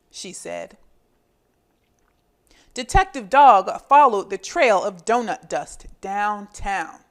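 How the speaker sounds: put-on lively read-aloud for young children; storybook reading. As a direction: read it like a picture book to little kids. Reading picture book to children.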